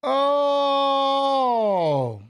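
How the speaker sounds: long, high held "oh"; drawn-out high cheer; celebratory holler. Real, not acted. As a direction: holler one long note, falling at the end. Holler after announcer names winner.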